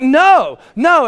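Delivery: loud, high re-enacted refusal; angry refusal. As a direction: refuse your wife at a shout. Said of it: "I'm shouting at her".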